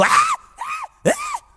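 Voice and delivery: very high shrieks of fright; terrified shrieks. Retold or imitated. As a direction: shriek like you've just seen a cockroach. Wife screaming at a cockroach. (gritos agudísimos de susto)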